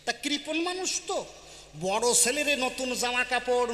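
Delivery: usual speaking voice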